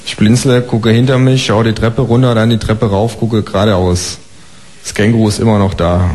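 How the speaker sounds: usual speaking voice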